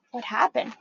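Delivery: ordinary delivery, nothing marked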